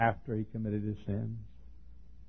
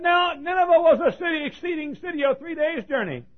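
lifted, ringing scripture read well above speaking pitch; scripture read out in a high ringing preacher's voice; 3 dB louder than usual. Acted. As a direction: intone the verse high and grand, like a proclamation. Preacher reads scripture aloud.

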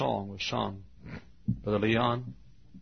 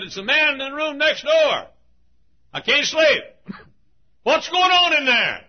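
high, loud complaint from next door; a cranky neighbour shouting through the wall; annoyed neighbour; 10 dB louder than usual. Retold or imitated. Annoyed neighbour voiced in story.

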